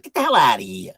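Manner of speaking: loud, laughing Brooklyn brush-off; Brooklyn tough guy. Shown as a demonstration. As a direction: throw him out Brooklyn style. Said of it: "I can say that too".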